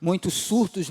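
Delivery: normal talking voice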